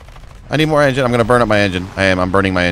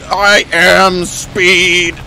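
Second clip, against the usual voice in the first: movie racer quote; 9 semitones higher, 4 dB louder, much slower than usual. Pretend. Quoting race car line.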